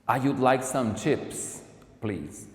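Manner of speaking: slow, clear textbook delivery; slow model sentence. Shown as a demonstration. Teacher modelling a British order for students. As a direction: say "I would like some chips" slowly and clearly. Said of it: "Ela pediria de forma diferente".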